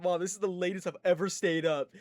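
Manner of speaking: imitating a kid up too late; thrilled bad kid. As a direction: announce it to mom, amazed at yourself. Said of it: "I felt like such a bad kid".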